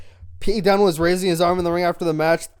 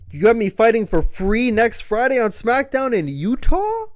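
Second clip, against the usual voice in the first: loud, high outraged protest; indignant; 8 semitones higher, 7 dB louder than usual. Retold or imitated. Logan complaining to Nick Aldis, loud high outrage. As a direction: protest the insult, voice climbing. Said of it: "he says".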